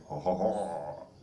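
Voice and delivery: drawn-out mock Japanese "ho" sounds; slow parody of Japanese intonation; mock Japanese accent. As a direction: briefly imitate a caricatured Japanese accent, slowly. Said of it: "not trying to add, you know, like".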